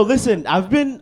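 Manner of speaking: normal talking voice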